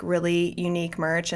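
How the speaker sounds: ordinary talk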